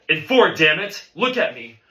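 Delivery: irate bandleader losing patience; angry outburst. Acted. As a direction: snap at the student to pay attention. Teacher berating a drummer.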